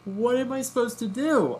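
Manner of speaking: high desperate wail. Real, not acted. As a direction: cry out helplessly. Caught, desperate.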